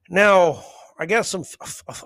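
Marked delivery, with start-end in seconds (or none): 1.3-2.0 stuttering on the F; real stutter. Real, not acted. Host moving to the next topic, accidentally stuttering. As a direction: catch repeatedly on the F before "few". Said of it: "I don't know why I started stuttering".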